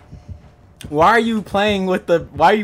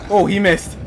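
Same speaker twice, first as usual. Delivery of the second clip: ordinary talk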